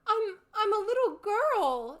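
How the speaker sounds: high, tearful, unsure stammer; not convinced herself; doubtful. A narrator's reading. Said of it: "said Alice rather doubtfully".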